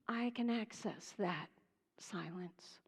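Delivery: soft, hushed silence; whispered silence. Real, not acted. Speaking of silence.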